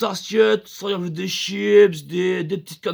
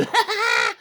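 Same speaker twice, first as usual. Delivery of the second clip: high breathy mock moans, laughing; high moaning; 13 semitones higher than usual. Moans from the right-hand room of the casting corridor, high. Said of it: "À droite, ça fait".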